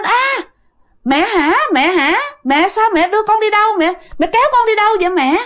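mock startled cry, high-pitched and hurried; high, pleading questions, acted out without much extra volume; retold cry out. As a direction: imitate a daughter calling out in confusion. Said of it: "thì mẹ mới la lên".

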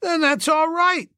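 high-pitched hearty approval. A narrator's reading. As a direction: settle it heartily, pitched right up. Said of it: "said the chappie heartily".

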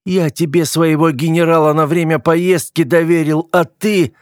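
high-pitched accusation left hanging; wounded, indignant outburst; angry reproach. A narrator's reading. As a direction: reproach him for betraying your trust. Hurt brother accuses him over pet.

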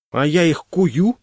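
offended. Acted. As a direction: take it as a personal insult. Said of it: "обиделась она".

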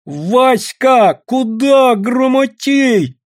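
high, drawn-out holler; loud high yell; shouting after someone. A narrator's reading. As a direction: call after him, stretching each word high. Women shouting after the running man.

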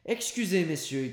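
high, loud call to friends; shouting from afar. A narrator's reading. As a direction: shout over to the guests. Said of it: "cria Grandet".

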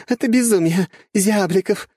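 high female voice after a burst of laughter; laugh, then exclaim in wild delight; laughing loudly. A narrator's reading. Young woman laughing as acid rain hits the enemy, gleeful exclamation. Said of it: "хохотала девушка".

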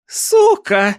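one very high, loud screech; shrieking aunt. A narrator's reading. Aunt shrieking at her niece in rage, high screech. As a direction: screech it with fury. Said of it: "заверещала Корделія".

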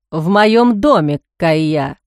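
"В моём доме" stressed with weight, "Кайя" dropped low; emphatic. A narrator's reading. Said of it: "С нажимом подчеркнул он".

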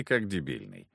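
ordinary delivery

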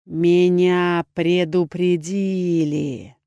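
low, rasping troll voice; deep hoarse troll. A narrator's reading. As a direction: drawl it from deep in the chest like a troll. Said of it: "глубоким хрипловатым голосом". (низкий хриплый голос тролля)

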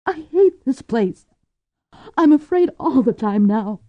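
frightened woman close to tears; Cordelia breaking down. A narrator's reading. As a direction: let it spill out against her will. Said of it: "words broke from her lips against her will".

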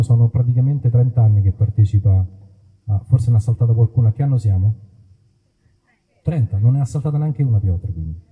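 ordinary delivery

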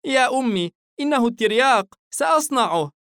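put-on high little boy's voice; sweet, innocent small boy; innocent child voice. A narrator's reading. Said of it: "حاييم ببراءة".

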